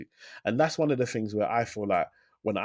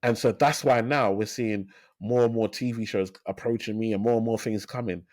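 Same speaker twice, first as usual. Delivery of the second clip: usual speaking voice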